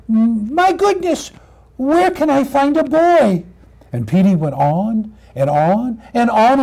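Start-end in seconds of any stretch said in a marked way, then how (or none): 0.1-3.4 high, plaintive puppy voice; forlorn little puppy. Acted. Lonely puppy searches for a boy, plaintive puppy voice. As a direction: wonder helplessly as a lost puppy.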